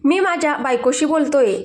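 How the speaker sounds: ordinary delivery, nothing marked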